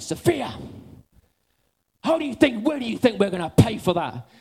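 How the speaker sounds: mock-exasperated dad scolding his daughter; sing-song angry parent rebuke. Scolding daughter for breaking things.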